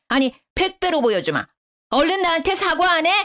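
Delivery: lightly acted angry tirade; mild narrated yelling. A narrator's reading. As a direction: demand an apology in mock outrage. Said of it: "소리를 지르더라고요".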